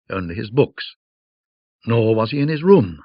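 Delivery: ordinary delivery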